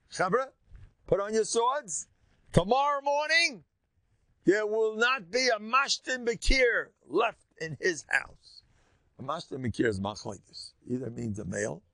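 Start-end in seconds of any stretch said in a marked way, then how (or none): 0.1-8.6 high, loud rallying call, drawn out at the end; commanding battle order. Acted. David ordering his men against the miser, commanding shout. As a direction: call the troops to arms, voice way up.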